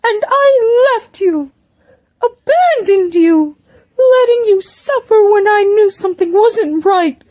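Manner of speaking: high, drawn-out tearful wail; high-pitched melodramatic lament; anguished confession. A narrator's reading. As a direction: confess it in a hammed-up crying voice. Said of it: "Ryoto felt his knees weaken".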